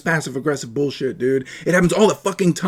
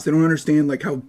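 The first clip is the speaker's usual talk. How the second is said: usual speaking voice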